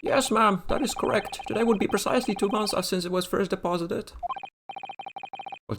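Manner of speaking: high nervous shopkeeper. Acted. Voices pawnbroker character.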